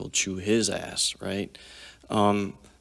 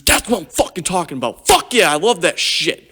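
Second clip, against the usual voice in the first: much higher, louder, hyped-up praise; retold thrilled shout; 9 semitones higher, 9 dB louder than usual. Retold teammate hugging him after a big hit, thrilled shout. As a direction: roar it like a proud, fired-up teammate.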